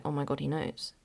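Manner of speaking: low, soft realisation; hushed dread. Retold or imitated. Daughter sure dad saw the bank statement, hushed dread. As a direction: drop it low, as a private panic. Convinced dad has found out.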